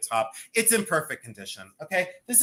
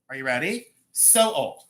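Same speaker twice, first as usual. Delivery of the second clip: ordinary delivery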